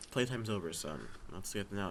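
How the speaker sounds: a hint of a cold villain dad, kept quiet; stern father. Father on the phone to his son, quietly menacing. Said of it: "Bryan Cranston on the phone saying".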